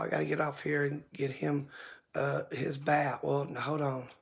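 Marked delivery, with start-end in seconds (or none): none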